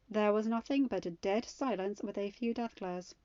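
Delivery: ordinary delivery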